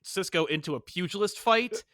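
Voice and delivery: usual speaking voice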